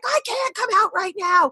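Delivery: strained high retort of a mom at her limit; imitated frantic scream. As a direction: re-enact a frantic yell through a door. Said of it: "I'm screaming at the top of my lungs".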